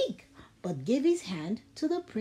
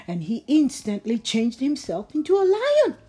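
ordinary delivery

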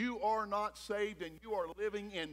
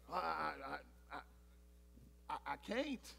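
stammering refusal; 6 semitones lower, 13 dB quieter than usual. Acted. Daniel stammers refusing the king.

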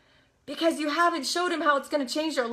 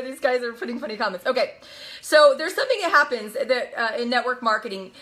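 ordinary talk

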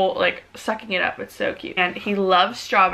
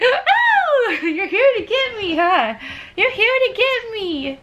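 put-on very high, delighted squeaky baby voice with a laugh; voicing the baby; 12 semitones higher, 3 dB louder, slower than usual. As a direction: squeal it like a thrilled baby greeting dad. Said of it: "and he's just like".

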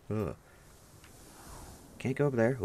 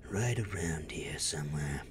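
sneaky whispered search; 4 semitones lower, 5 dB quieter than usual. Pretend. Gamer whispering while searching the map. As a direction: whisper it, creeping up.

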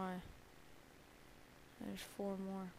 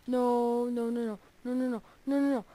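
loud repeated nos in real panic; panicked nos; 3 semitones higher, 9 dB louder than usual. Real, not acted. Gamer panicking as something hits him. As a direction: cry no again and again as you die. Being hit, dying.

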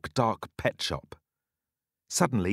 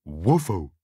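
dog bark; 6 semitones lower than usual. Acted. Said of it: "barks the dog".

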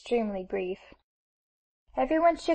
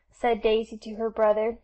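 ordinary delivery

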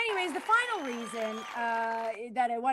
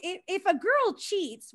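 ordinary talk

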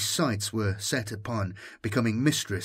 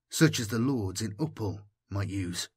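ordinary delivery

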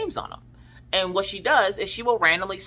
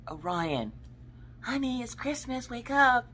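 much softer and higher, gentle; soft coaxing wake-up; 9 semitones higher, 8 dB quieter, slower than usual. Retold or imitated. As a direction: coax a sleeping child awake tenderly. Gently waking sleeping son.

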